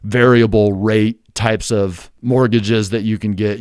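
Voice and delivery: ordinary delivery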